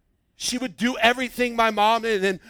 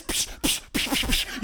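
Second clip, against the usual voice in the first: whispered psh sound effects; imitating a dancer's flashy moves; dance move noises; 6 dB quieter than usual. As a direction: puff out mock move sounds.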